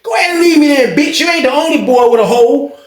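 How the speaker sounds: high, heated retold insult; scornful dismissal. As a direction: dare him to go.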